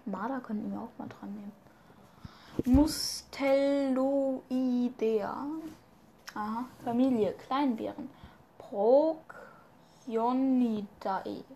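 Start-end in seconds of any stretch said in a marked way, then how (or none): 2.8-5.4 very slow, syllable by syllable; sounding out. Real, not acted. Reader struggling with a Latin family name, sounding out. 8.6-11.4 drawn out in separate syllables; very slow, halting attempt at a hard word; sounding out. Real, not acted. Reader tackling the Latin name for small bears, sounding out.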